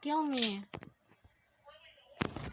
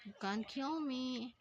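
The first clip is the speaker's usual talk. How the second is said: normal talking voice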